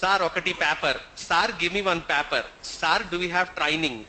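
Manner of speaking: put-on Telugu-accented English with open vowels. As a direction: imitate an Andhra speaker's English. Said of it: "the pronunciation becomes sar".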